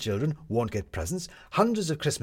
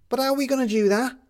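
put-on high, loud kid's voice; an eager boy asking, high and loud; captain asking Maddie for the plan, high and loud; 14 semitones higher, 6 dB louder, faster than usual. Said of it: "said the captain".

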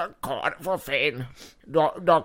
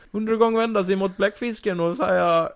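ordinary talk, nothing marked

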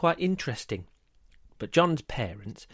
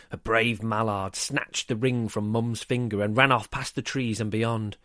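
plain reading voice

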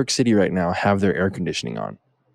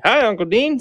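high, sing-song model greeting; prompting a toddler; 13 semitones higher than usual. Shown as a demonstration. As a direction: show a small child how to say hello.

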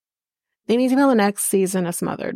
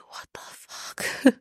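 stunned whisper; 8 dB quieter, much slower than usual. Retold or imitated. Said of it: "So I'm just like".

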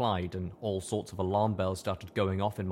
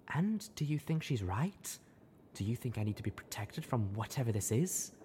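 quiet wary question; 2 semitones higher, 5 dB quieter than usual. A narrator's reading. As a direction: ask quietly, bracing for the answer.

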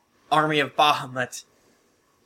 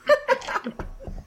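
sudden high-pitched burst of laughter; surprised high laugh. Real, not acted.

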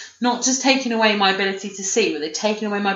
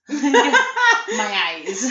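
higher, hammed-up mock wail with laughter; imitating a man's complaint; 6 semitones higher than usual. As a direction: exaggerate his lament, pitched up and drawn out. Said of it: "Every time he said it".

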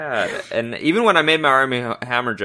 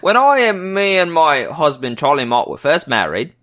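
put-on British accent, pitched up at the start; 3 semitones higher than usual. Cohost jumps in to read Angelique's words for the host, mock British accent. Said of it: "I was doing the accent for you".

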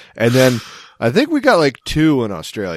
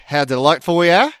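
higher, lilting mock-British Australian lilt; mock posh accent; 6 semitones higher than usual. Host parodying Australians' adopted accent, high mock posh lilt. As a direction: preen in a put-on posh Aussie accent. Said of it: "what if we start talking like this".

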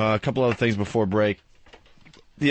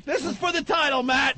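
excited shout. Acted. Mock sports commentary climax.

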